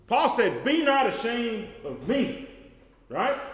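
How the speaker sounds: loud, high preaching shout; full-force preaching. Acted. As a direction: lift the voice and proclaim it out loud.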